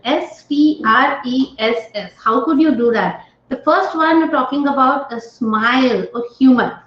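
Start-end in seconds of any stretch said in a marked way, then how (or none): 0.0-2.1 slow letter-by-letter spelling. Shown as a demonstration. Teacher spelling the acronym for students, slowly. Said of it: "these six words as a transition".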